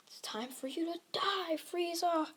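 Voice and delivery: high-pitched heroic threat, held back in volume; mock battle cry without shouting. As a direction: declare the enemy's doom. Hero threatens villain while charging.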